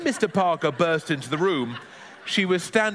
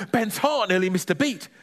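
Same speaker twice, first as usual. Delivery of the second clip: usual speaking voice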